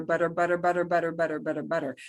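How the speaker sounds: "butter" repeated, the tt as a quick flap; butter flap drill. Shown as a demonstration. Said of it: "That sound that comes out with butter".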